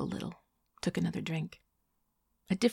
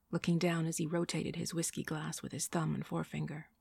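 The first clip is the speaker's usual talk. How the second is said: ordinary delivery, nothing marked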